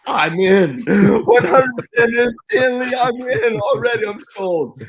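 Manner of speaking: very high, loud excited squeal, half laughing; giddy fanboy. Retold or imitated. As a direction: squeal your instant yes like a starstruck fan. Retelling his giddy fanboy answer.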